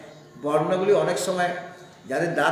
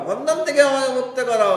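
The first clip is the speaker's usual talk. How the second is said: usual speaking voice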